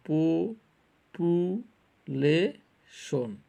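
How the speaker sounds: "পো-, পু-, লে-, শোন" as four drawn-out pieces with pauses; syllable by syllable. Shown as a demonstration. Teacher shows four syllables to students, "পো-, পু-, লে-, শোন" each held apart. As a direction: split it slowly: "পো-" ... "পু-" ... "লে-" ... "শোন". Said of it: "চারটা syllable".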